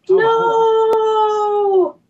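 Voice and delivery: loud, high cry of dismay; a long, high, stricken no. Real, not acted. Guest hearing the series ends, crying out no in dismay.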